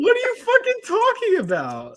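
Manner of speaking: very high-pitched retold disbelief; incredulous outburst. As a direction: squeal it in mock outrage. Retelling outraged reply to woman.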